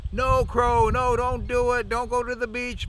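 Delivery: high, loud desperate cry; high frantic yell of the animals. Acted. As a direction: shout a warning after him. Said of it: "the animals were yelling".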